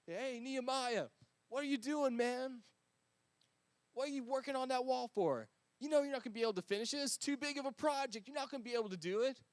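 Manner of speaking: mocking enemies. Enemies jeer at Nehemiah on the wall, high and mocking. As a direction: taunt him, you think he'll fail.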